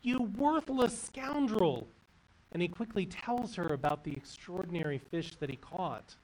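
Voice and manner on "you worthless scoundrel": high, slow, scornful rant. A narrator's reading. Wife rants at her husband who came home empty-handed, high scornful rant. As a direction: spit the insult out at him. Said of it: "she rants at him".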